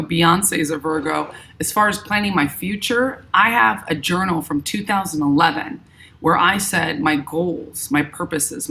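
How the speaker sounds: normal talking voice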